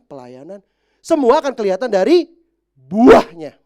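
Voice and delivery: high, loud fiery preaching; preaching at full force. Acted. Pastor exhorting the congregation, fiery loud preaching. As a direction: preach it out with rising fire.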